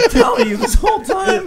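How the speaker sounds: high, loud, breaking into laughter; laughing. Real, not acted. Host teasing co-host about having no legs, laughing hard. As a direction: shout it through real laughter.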